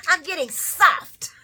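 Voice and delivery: high, loud laugh breaking in. Retold or imitated. Vlogger retelling Tracy's line while laughing. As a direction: giggle through it. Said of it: "laughing retelling".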